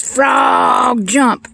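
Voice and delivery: high, loud holler at a frog; mock scientist shouting an order; yelled command. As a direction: stretch the first word and yell it. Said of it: "kept yelling".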